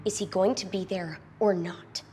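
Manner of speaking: normal talking voice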